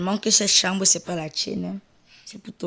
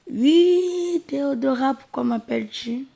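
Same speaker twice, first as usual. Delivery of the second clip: high, loud sing-song mom reply; mother's voice; 7 semitones higher, 6 dB louder than usual. Acted. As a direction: call back as the doting mother. Mère répond à sa fille. (la mère répond fort à Théodora)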